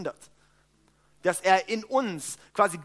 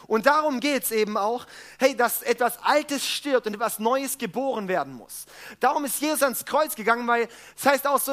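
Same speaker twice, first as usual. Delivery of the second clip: building preaching; 3 semitones higher than usual. Acted. Preacher drives home rebirth to the congregation, full preaching. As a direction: preach it out to the room.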